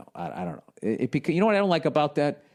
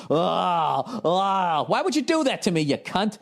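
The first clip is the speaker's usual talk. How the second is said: high, breathy mock sex moans; mock moaning lover; 7 semitones higher, slower than usual. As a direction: ham up an ecstatic partner. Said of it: "picture you with your head thrown back".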